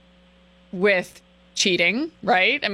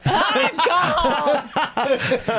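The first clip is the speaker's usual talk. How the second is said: laughing mock calm; 5 semitones higher than usual. Hosts mock the quarrel.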